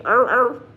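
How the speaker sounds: short barked er, er; mock dog bark. Mocking a name that sounds like barking, barked. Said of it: "sound like a dog bark".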